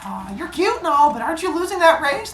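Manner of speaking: high-pitched squirrel cheerleader voice; cutesy cheerleader. Acted. Squirrel cheerleader to flirting rabbit, cutesy teasing.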